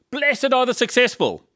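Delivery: high-pitched mock proclamation; the world's proclamation. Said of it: "The world says".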